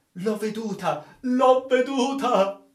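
obsessed, high-pitched insistence; frantic exclamation. Acted. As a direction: insist feverishly, rising to a long cry. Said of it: "esclamò egli".

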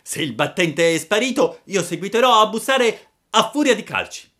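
acuto e fortissimo, rabbioso; grido furioso. A narrator's reading. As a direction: minaccia urlando. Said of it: "gridò Pinocchio ... collera". (Pinocchio furioso grida, fortissimo e acuto)

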